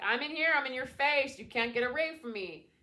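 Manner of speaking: high, loud and pushy; loud in your face. Pretend. Ego voice pushing in your face, loud and high. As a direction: shove it in their face, loud. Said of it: "It's not as loud".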